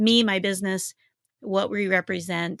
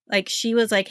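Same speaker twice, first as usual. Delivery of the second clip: normal talking voice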